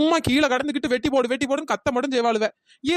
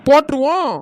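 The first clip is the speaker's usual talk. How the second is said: calling out; 9 dB louder than usual. Acted. Girl in the palm tree shouts down to the women, loud. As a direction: shout it down from the treetop.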